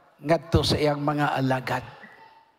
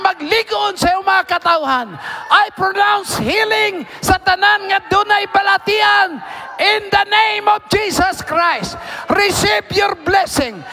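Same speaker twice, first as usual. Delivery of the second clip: full-force prayer preaching; 12 semitones higher, 7 dB louder than usual. Real, not acted. Preacher pronouncing healing and power over the church, high forceful shout.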